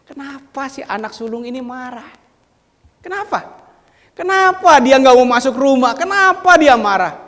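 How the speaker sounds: loud, high-pitched rhetorical questions; preaching questions. Acted. Preacher loudly asking the congregation why the son is angry. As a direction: press the question harder each time.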